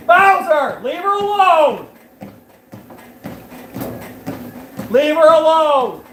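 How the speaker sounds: high, loud, drawn-out holler; real yell at a misbehaving pet; scolding yell. Real, not acted. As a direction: shout at the dog to back off. Calling off a roughhousing dog.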